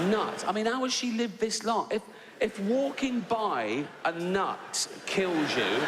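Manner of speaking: incredulous rant. Retold or imitated. Ranting about nut allergy passenger.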